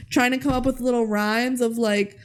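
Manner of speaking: usual speaking voice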